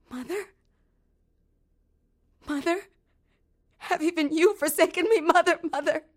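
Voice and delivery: high, loud, anguished calling; calling out into the empty wind; forsaken cry. Acted. Girl abandoned, calling her mother.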